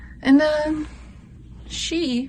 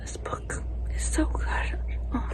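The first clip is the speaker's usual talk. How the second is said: breathy secret whisper; 6 semitones higher, 7 dB quieter than usual. Real, not acted. Whispering to viewers about a book she loved. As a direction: hush it like a secret.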